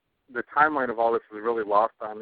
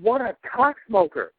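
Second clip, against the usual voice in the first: higher, slow, disgusted insult; indignant outburst; 9 semitones higher, 3 dB louder, slower than usual. Retold or imitated. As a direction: spit out the insult in disbelief. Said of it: "And I was like".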